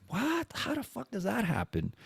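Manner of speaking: high, soft squeak of disbelief; retold astonished question; incredulous. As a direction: react baffled, voice pitched right up. Retold disbelief at friend's luck.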